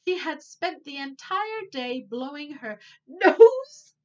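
ordinary delivery, nothing marked

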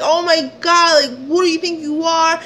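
high, exasperated challenge; indignant telling-off. Retold or imitated. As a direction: retell it as an outraged confrontation. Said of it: "ramming him a new one".

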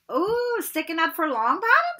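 high, loud sing-song taunt. Acted. Pansy taunts Parvati for defending Neville, high and sneering. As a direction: tease her with a sneer. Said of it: "a hard-faced Slytherin girl".

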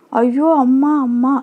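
mild acted cry of fear for mother; frightened wail. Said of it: "அலறிய காந்தியின் குரலில் பயம்".

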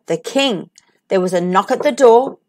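usual speaking voice

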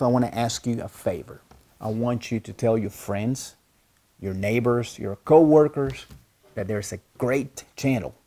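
measured promo read, steady and clear; outro promo read. Acted. YouTube teacher asking viewers to share, promo read. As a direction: pitch the channel like a radio spot.

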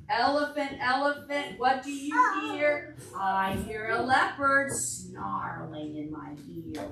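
singsong refrain, a bit slow; storybook chant; reading to children. Acted. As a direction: chant the book's refrain for toddlers. Reads picture book to children.

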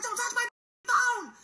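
very high, loud, furious shriek; frantic scream. Retold or imitated. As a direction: reenact screaming someone away. Frantic refusal to kids, retold.

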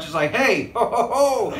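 ordinary talk

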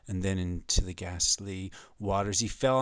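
ordinary talk, nothing marked

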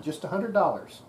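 ordinary talk

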